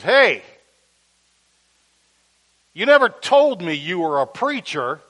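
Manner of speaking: loud call across the diner. Retold or imitated. Harry behind the counter calls to the preacher, loud. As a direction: call out the line, surprised.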